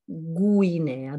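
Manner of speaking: « Guinea » dit gou-i-nè-a, U allongé; bien détacher le U de « Guinea »; U prononcé, appuyé. Shown as a demonstration. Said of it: "Même chose pour". (« Guinea » avec un gou initial net)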